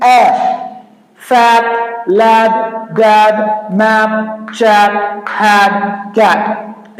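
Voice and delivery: ए then फैट लैड डैड, each word drawn out; ae sound demo. Shown as a demonstration. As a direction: model the ae in मैप and कैट slowly. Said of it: "तो हम ए का sound कैसे करेंगे?". (ध्वनि के साथ शब्द धीरे बोलें)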